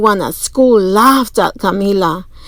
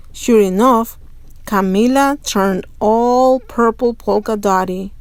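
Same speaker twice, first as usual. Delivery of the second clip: usual speaking voice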